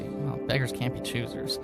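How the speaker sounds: usual speaking voice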